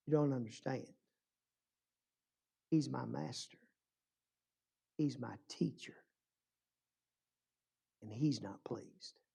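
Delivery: low, very soft confession; hushed sorrow. Retold or imitated. Violinist explaining his master's displeasure to his friend, hushed and grave. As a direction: drop nearly to a whisper, with long pauses.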